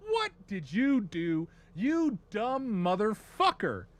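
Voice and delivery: slow, high, drawn-out accusation; mock furious scolding. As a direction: stretch every word in hammed-up outrage. Eldar scold humans and Inquisitor.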